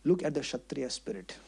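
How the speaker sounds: low, soft aside; hushed, low musing to oneself; saying to himself. Retold or imitated. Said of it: "was saying to himself".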